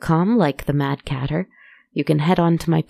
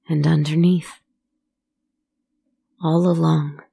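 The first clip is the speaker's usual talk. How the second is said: haunting first-person storytelling; eerie narration. A narrator's reading. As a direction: tell it like a ghost story by the fire. First-person horror monologue over music.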